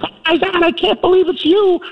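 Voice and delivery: high squealing excited fan; starstruck fan lady. Retold or imitated. Older lady gushing at the star. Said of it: "She's like".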